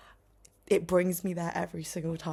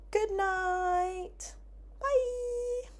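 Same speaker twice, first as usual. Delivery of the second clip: much higher, lilting good night and bye; sing-song goodbye; 14 semitones higher, much slower than usual. Real, not acted. Vlogger waving her viewers off, high and sing-song. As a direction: sign off in a cute high voice. Signing off the video.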